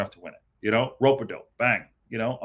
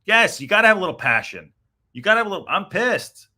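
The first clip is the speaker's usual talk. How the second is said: loud, higher, heated passion; real fired-up anger; 8 semitones higher, 6 dB louder than usual. Real, not acted. Fan on his stream erupts about the losses, loud and heated. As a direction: let the frustration burst out.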